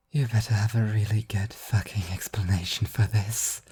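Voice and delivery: hoarse half-asleep whisper; groggy whisper. A narrator's reading. Devil groaning at the angel who woke her, whispered. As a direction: breathe it out, barely awake and annoyed. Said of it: "Kaiser moaned".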